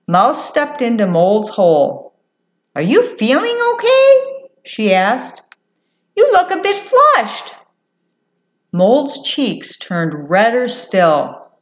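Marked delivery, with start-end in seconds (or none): 2.8-4.5 very high mouse voice, rising question; concerned little mouse. Acted. Mouse asks Mole with concern. 6.1-7.5 very high mouse voice, rising question; concerned little mouse. Acted. Mouse remarks on Mole's cheeks.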